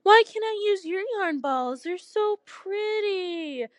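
high, whiny pout. A narrator's reading. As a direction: plead with a pout, stretching the last words. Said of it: "Hecate pouted".

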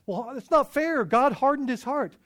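lightly acted indignant objection; mock protest. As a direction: imitate people crying unfair. Imitated objectors protesting to scripture.

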